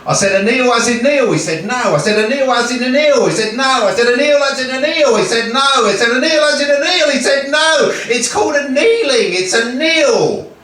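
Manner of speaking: back-and-forth climbing in pitch to an exasperated finish; escalating exasperation. Acted. Achilles and swordsmith stuck on a word, escalating exasperation. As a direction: wind it tighter with every repeat. Rapid misunderstanding builds to exasperation.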